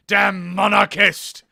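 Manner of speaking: loud, drawn-out denunciation; angry outburst. Acted. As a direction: spit out a slow, loud accusation. Acted accusation in game dialogue.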